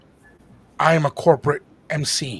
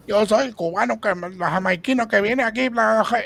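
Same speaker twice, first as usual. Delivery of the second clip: higher, hyped Spanish stage patter; Bacon's stage act; 7 semitones higher, 4 dB louder than usual. Retold or imitated. Imitating friend Bacon's stage act on the phone. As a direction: rattle it off like he does on stage. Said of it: "I start going off like he does on stage".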